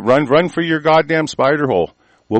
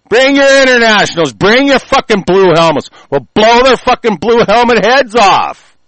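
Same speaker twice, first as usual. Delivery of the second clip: much higher, louder roaring dare; defiant yelling; 8 semitones higher, 6 dB louder than usual. Real, not acted. Daring foreign troops to come, roaring defiantly. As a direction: shout the challenge as loud as you can.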